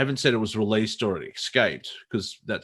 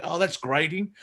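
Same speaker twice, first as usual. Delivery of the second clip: mock pained; 7 semitones higher than usual. Acted reaction to own accent.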